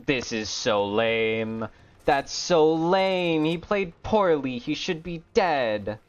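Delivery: slow moaning complaint about bad luck; whiny complaint. Real, not acted. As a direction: whine it out, fed up. Frustrated whine over bad luck.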